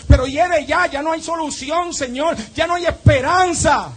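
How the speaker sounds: high, forceful preaching cry; despairing cry. Acted. Preacher as grieving sister telling the Lord hope is gone, despairing. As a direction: cry it out, building to the end.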